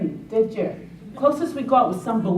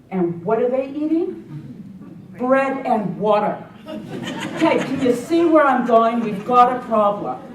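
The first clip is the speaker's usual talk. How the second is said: emphatic; 4 semitones higher, 6 dB louder than usual. Real, not acted. Driving home his point.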